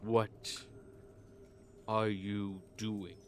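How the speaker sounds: very slow, quiet ruler; unimpressed ruler. Acted. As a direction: draw each word out with cool disdain. Ruler looks down on the flatterer.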